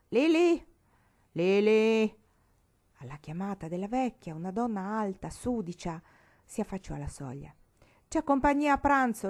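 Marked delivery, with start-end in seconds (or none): 0.1-2.2 shouting a name, much higher and louder; calling out loud. A narrator's reading. Old woman calling her servant Lili from the veranda, loud. 8.1-9.3 loud, high order; bossy call. A narrator's reading. Old woman announcing guests to Lili, loud and high.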